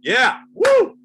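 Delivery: high, loud whoops at the therapist; manic whooping. Retold or imitated. Comic answering his therapist with loud whoops. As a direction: dodge the question by whooping. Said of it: "I'm like".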